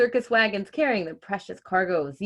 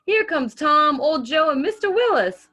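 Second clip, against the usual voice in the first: excited announcing; 9 semitones higher, 7 dB louder than usual. Acted. Mama calling out at parade.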